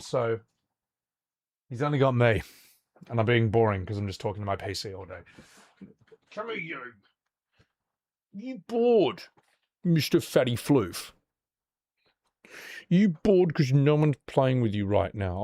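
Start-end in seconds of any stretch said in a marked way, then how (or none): 6.3-14.9 tender pet voice; cooing to the cat. Real, not acted.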